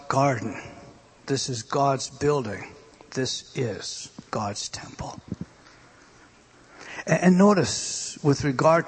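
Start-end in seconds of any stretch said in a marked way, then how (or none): 3.1-5.2 hushed whisper. Real, not acted. Preacher whispering to the congregation in awe. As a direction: whisper it, slow and reverent.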